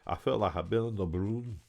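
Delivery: put-on thick Newcastle Geordie accent; mumbled Geordie from a dazed patient. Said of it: "speaking with a Newcastle accent and using Geordie slang".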